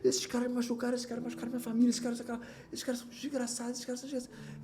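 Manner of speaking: mock-bitter refusal trailing off; retold bitter refusal. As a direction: spit out the grudge, then let it sink. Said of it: "Eu falei".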